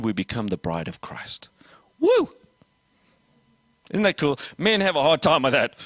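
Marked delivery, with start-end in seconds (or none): 0.0-1.5 hushed whisper, much softer; dramatic whisper. Acted. Preacher revealing the end to the church, whispered. 2.0-2.3 high whooping cheer; excited woo. Real, not acted. Preacher cheering to the congregation, whooping. Celebrating the reveal.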